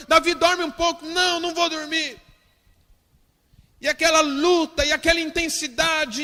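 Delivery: high, loud, forceful sermon delivery; fiery preaching. Acted. Pastor telling the congregation of David's fasting, full-force preaching. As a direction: preach it at the top of your lungs.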